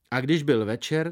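ordinary delivery, nothing marked

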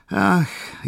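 impatient, high-pitched sigh of a word. A narrator's reading. Dona Paolina to Katerina, impatient. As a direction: let impatience push the pitch up. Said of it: "jevila dona Paolina svou netrpělivost".

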